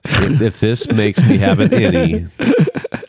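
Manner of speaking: laughing through the words; chuckling as he talks. Real, not acted. Joking while tasting beer.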